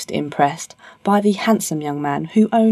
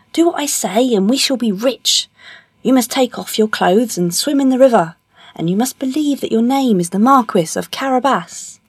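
higher, insistent storybook cat; scheming cat; 6 semitones higher than usual. Acted. Puss instructing his master in a plan, insistent. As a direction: give orders in a raised cat voice.

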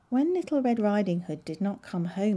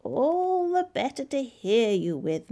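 high, slow, creepy-sweet reply; drawn-out fake-granny voice; wolf as grandmother; 6 semitones higher than usual. A narrator's reading. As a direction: answer slowly as the disguised wolf. Wolf posing as grandmother replies.

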